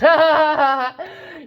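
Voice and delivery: loud burst of laughter. Real, not acted. Big laugh before war rant.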